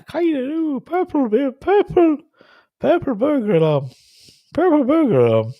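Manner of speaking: high-pitched, badly faked Scottish accent; attempted Scottish accent. Shown as a demonstration. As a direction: struggle through a squeaky Scottish accent. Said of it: "in a Scottish accent".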